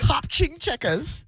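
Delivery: slow, stretched mock Chinese accent on Hop ching checkers; put-on Chinese-sounding accent. Shown as a demonstration. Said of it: "I don't know why I put an accent on that".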